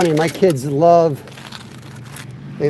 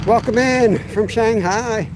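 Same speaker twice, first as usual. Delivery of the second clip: higher, slow, sing-song greeting to viewers; cheery welcome; 7 semitones higher, slower than usual. Acted. Host greeting viewers at new spot, cheery and drawn out. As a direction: stretch out the hello like a show host.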